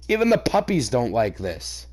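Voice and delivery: normal talking voice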